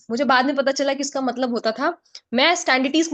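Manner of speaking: ordinary delivery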